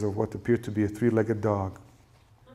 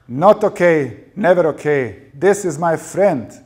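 high, very loud, insistent protest; drunken husband screaming back at his wife; furious yelling; 7 semitones higher, 11 dB louder, slower than usual. A narrator's reading. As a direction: yell it in defence of a friend. Dado still screaming at his wife.

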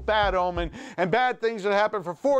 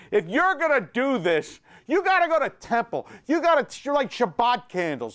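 ranting; 6 semitones higher than usual. Acted. Exasperated rant.

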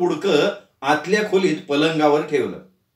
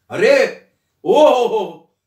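loud, high "ओ हो हो" of amazement; surprised exclamation; 4 semitones higher, 5 dB louder, much slower than usual. Acted. Son reacts loudly to seeing the costume, amazed. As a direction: gasp out in delighted surprise.

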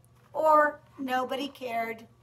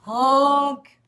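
loud, long truck-horn honk; big storybook honk; yelled honk; 11 dB louder than usual. A narrator's reading. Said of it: "yelled the dump truck".

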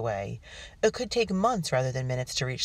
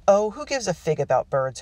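chiding; 5 semitones higher, 5 dB louder than usual. A narrator's reading. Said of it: "Hope chided".

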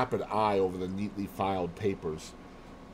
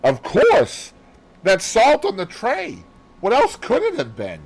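high, loud exclaiming; excited, impatient outburst; exasperated insistence; 11 semitones higher, 8 dB louder than usual. Acted. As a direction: insist as if it were obvious. Hastings exasperated, insisting on theory.

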